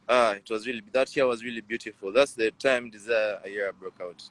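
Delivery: ordinary talk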